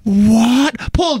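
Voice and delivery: a loud, high, startled what; shocked what. Real, not acted. Cohost hears the gossip is two weeks old, loud surprised what. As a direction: blurt it out in real shock.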